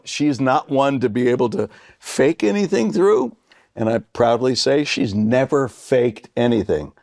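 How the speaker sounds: ordinary talk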